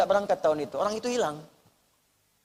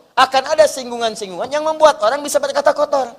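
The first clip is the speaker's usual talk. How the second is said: louder, higher warning sermon; fiery preaching; 5 semitones higher, 6 dB louder than usual. Real, not acted. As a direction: preach a stern warning with force. Preaching at full force.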